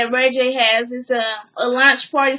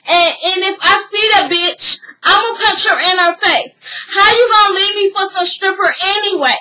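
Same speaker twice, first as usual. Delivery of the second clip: louder, higher-pitched mock tirade; acted-out angry rant; 5 semitones higher, 5 dB louder than usual. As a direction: act out a jealous ex going off. Retells her angry outburst.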